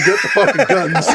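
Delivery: mild mock yell, a laugh underneath; half-laughing imitation of yelling; retold bathroom screaming. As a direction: re-enact a scream without full force. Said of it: "I'm in the bathroom screaming".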